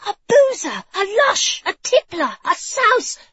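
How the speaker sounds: high-pitched, indignant hissing tirade; hissed. A narrator's reading. Bride listing her groom's faults to the king, hissed. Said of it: "she'd hissed".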